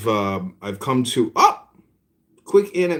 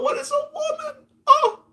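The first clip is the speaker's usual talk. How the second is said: mock wail. Despairing at wasted time, high mock wail. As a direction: squeal it in mock anguish. Mocking the argument once more.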